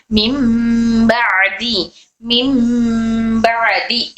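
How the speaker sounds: मिम बादी drawn out very slowly with a hummed मीम; slow recitation demo. Shown as a demonstration. Teacher recites मिम बादी slowly for students. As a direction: stretch मिम बादी, holding the nasal मीम. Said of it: "इस तरह से".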